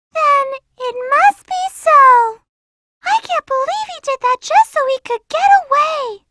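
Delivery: ordinary talk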